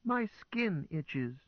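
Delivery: high, slow fretful complaint. A narrator's reading. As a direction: fret like a worried child.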